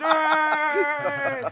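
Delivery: high, loud whoop; crowd cheer. Acted. Crowd cheers the champion, loud whoop.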